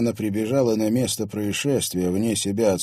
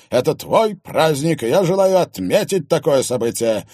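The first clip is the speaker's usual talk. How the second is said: loud insistent husband; husband insisting to his wife, loud and slow; firm, slow and loud; 8 semitones higher, 7 dB louder than usual. Acted. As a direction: lay it down slowly and firmly. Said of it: "твёрдо сказал ей Иван".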